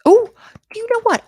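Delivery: jumps high and loud on know; sudden realisation. Real, not acted. Teacher suddenly realising her own mistake, surprised. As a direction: caught off guard, oh wait.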